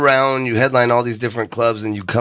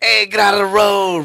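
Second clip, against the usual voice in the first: high-pitched Boston road-rage yell; rude Boston local; 8 semitones higher than usual. Acted. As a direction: yell it like an impatient Boston driver. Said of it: "that's just their attitude in Boston".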